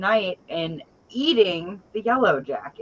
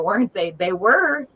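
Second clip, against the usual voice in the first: ordinary delivery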